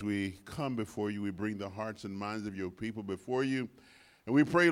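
usual speaking voice

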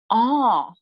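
high, loud, drawn-out "ah"; dawning realization. Retold or imitated. Guest retelling learning what clairvoyancy is, dawning realization. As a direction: let the revelation land on one sound.

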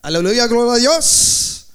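loud exclamations in a Spanish accent; showing off a loud Spanish accent. Shown as a demonstration. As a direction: proclaim it like a Spanish-speaking preacher, accent and all. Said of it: "I have the accent".

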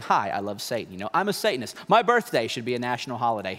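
lightly voiced Satanist in a low register; mock Satanist. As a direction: drop low for a mock self-introduction. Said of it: "You're probably not walking around saying".

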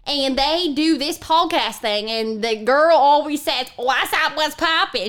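bossy Southern granny, rising to a high finish; mean Southern granny. Acted. Granny mocks a podcasting girl, scornful Southern granny. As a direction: play a scornful old Southern grandma.

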